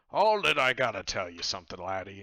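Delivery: loud opening, easing off; hearty captain launching a confidence; boisterous captain. Acted. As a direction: hail a drinking buddy loudly. Captain confides in player character.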